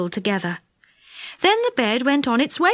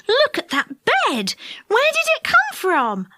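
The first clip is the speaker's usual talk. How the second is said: put-on high, amazed boyish exclamation; surprised; 12 semitones higher than usual. A narrator's reading. Boy pointing out a walking bed to friends, highly surprised. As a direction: ask in astonishment, pitch soaring. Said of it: "said Dick in surprise".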